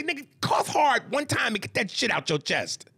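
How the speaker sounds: high, emphatic mock shout; retold exasperated urging. Retold urge to a friend.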